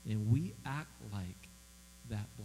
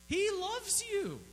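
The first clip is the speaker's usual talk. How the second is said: high sustained; slower than usual. Acted. Preacher repeats, higher and slower.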